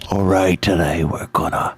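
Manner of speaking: low, put-on show-opener voice; put-on announcer voice. Game master opening the show, deep put-on voice. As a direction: drop deep to announce the plan.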